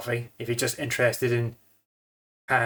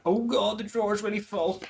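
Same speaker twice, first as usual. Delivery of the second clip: surprised; 9 semitones higher than usual. Real, not acted. Coffee drawer overflows.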